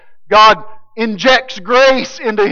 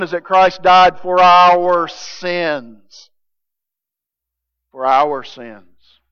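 slow solemn stress; 2 semitones lower, much slower than usual. Real, not acted. Slow solemn gospel statement.

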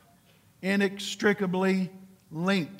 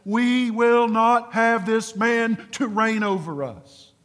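slow, higher, louder refusal; rebellious servants rejecting their king, slow and defiant; defiant rebels; 3 semitones higher, 4 dB louder, slower than usual. Acted. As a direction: declare it slowly like stubborn subjects refusing a king. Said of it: "It sounded like this".

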